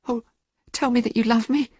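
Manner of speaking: high, desperate plea; pleading young woman, near tears; crying out. A narrator's reading. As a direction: beg him, pitched high. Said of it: "cried Sybil".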